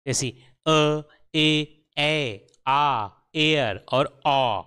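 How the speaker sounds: अ, ए, आ, एयर, आ each held and slowed; vowel recap. Shown as a demonstration. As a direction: sound out each vowel slowly. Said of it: "कई अलग-अलग उच्चारण".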